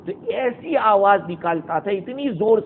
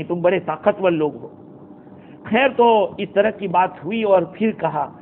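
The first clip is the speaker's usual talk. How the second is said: usual speaking voice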